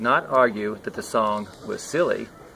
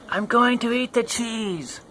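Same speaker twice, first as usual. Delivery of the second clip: put-on high, slow childish sing-song; child calling out; 12 semitones higher than usual. Child announcing to mother, high and slow. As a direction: announce it proudly like a little kid. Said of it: "called out the child".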